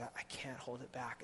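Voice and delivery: soft, low, worn-out admission; weary confession. Acted. Jeremiah confessing to God, soft and worn out. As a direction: barely get the words out, spent. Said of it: "And yet he said".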